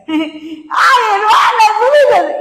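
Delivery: high, put-on plea for help; hammed-up high-pitched request; put-on pleading voice. Shown as a demonstration. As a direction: ask a church sister for help, exaggeratedly high. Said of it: "yo tengo que ha-, así".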